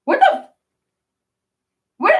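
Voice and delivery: very high startled squeak; retold shock. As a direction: cut off mid-curse in sudden disbelief. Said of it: "And I was like".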